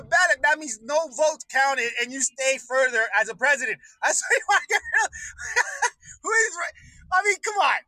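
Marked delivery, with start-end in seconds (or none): 5.1-6.2 a very high, breathless laugh; high squealing laugh. Real, not acted. Ranting about ballots, he dissolves into a squealing laugh.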